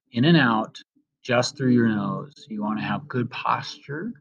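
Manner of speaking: slow meditation voice with long pauses; guided meditation. Acted. Host leading students through breathing, in a slow guided-meditation voice. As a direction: ease each instruction out slowly, leaving room to breathe.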